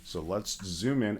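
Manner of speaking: normal talking voice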